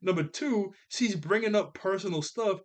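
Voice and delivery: high, loud, half-laughing protest; exasperated rant. Real, not acted. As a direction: argue in amused disbelief, pitch climbing. Arguing with Herb's logic, exasperated.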